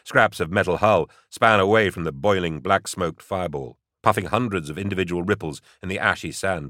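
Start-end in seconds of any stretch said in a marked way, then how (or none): none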